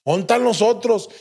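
high, urgent calling out; mock yell across a road; acted shouted question; retold shouting. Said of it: "le empecé a gritar".

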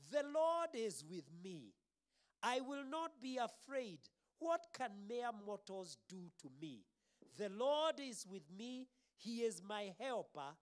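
high, loud preacher's declaration; psalm proclamation. Real, not acted.

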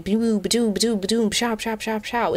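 high playful ba-doo and pshaw sound effects; synapse noises. Shown as a demonstration. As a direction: make your brain fire like cartoon zaps. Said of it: "synapses firing".

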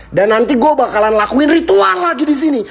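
shouted ritual call. Acted. Ghost hunter announcing the ritual to the spirits, shouting. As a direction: cry out to the spirits.

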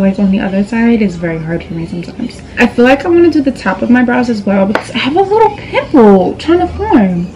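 ordinary talk

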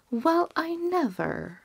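high, slow gasp of amazement; astonished. Acted. Townsfolk gaping at the gold, amazed. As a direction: let the surprise lift it way up.

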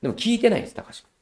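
normal talking voice

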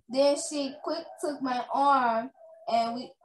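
ordinary delivery, nothing marked

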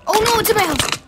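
high panicked shout; panicked yell. Real, not acted. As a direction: scream in real panic. Mob inside house, panic.

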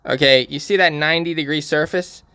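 ordinary delivery

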